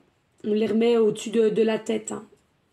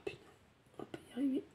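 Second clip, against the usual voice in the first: whispering; 18 dB quieter, much faster than usual. Real, not acted. Whispered aside while emotional.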